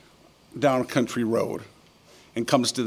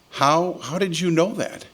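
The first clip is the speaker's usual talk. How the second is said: amazed question; 6 semitones higher than usual. Acted. Farmer amazed by the economist.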